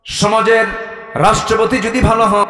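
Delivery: high, loud sermon delivery; full-force preaching. Real, not acted. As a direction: preach it out to a crowd, pitched high.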